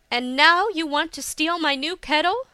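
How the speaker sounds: high, loud indignant question; scandalized rebuke; outraged accusation. A narrator's reading. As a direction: challenge him, voice rising. Hisa accuses Akio.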